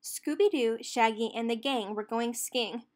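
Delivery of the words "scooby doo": ordinary delivery, nothing marked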